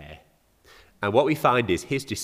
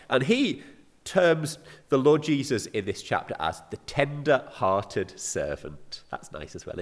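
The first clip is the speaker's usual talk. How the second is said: normal talking voice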